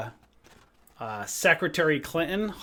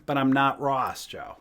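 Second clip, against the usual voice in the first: ordinary talk, nothing marked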